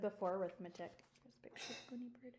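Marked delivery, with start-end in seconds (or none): 1.2-2.3 soft unvoiced whisper; hushed whispered aside. Real, not acted.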